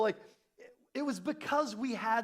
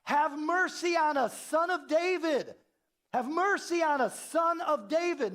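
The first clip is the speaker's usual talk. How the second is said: high, loud, slow cry for mercy; calling out; 10 semitones higher, 5 dB louder, slower than usual. A narrator's reading. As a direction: plead out loud like blind men calling after him. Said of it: "calling out".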